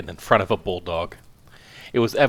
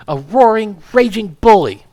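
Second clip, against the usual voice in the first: high and much louder, raging; furious shout; 12 semitones higher, 11 dB louder than usual. Acted. Wife shouts insults at her husband, furious. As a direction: hurl the insult at full volume.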